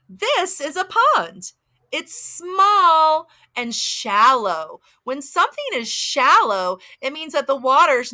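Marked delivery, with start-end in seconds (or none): 0.1-4.8 very slow, deliberate storytime reading; reading to a toddler. Acted. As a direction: stretch every word for little kids. Reads picture book slowly.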